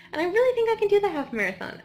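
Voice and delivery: high, loud, fast burst of confidence; thrilled runner bragging; excited. Real, not acted. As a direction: gush with pride. Excited boast about running.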